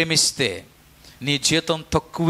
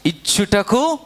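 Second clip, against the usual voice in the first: call out; 5 semitones higher, slower than usual. Real, not acted. Leads the response loudly.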